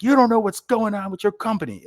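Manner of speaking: high sharp mock scolding of a boss; retold boss scolding. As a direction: bark it like an angry boss chewing you out.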